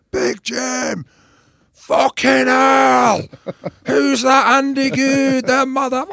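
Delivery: very high, loud drawn-out yells of street fans; hammed-up screaming Scottish crowd; mock fan shouting. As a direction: holler like wild fans spotting a star.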